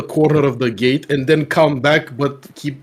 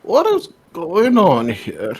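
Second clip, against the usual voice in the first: put-on old man voice; 4 semitones higher, slower than usual. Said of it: "I'm like".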